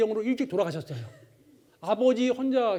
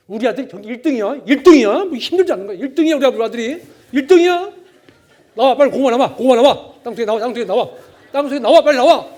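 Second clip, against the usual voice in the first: joyful shouting; 3 semitones higher, 13 dB louder than usual. Retold or imitated. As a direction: yell with pride at the sweet potatoes. Overjoyed father shouts while digging.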